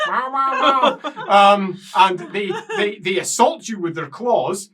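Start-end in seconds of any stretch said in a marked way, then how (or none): 0.1-1.3 high meows; high meowing reply; cat meowing. Acted. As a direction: answer meowing back. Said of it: "as that one goes".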